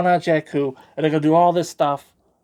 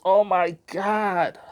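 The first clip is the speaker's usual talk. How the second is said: mock dramatic despair; 5 semitones higher, much slower than usual. Real, not acted. Mock dramatic reaction to vote.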